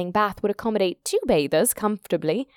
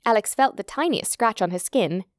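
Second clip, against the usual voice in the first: usual speaking voice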